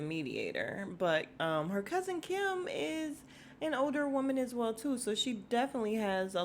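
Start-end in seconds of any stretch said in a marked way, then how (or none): none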